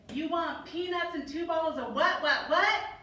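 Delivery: baffled cashier shouting what what. Retold or imitated. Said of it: "The cashier frowned and said".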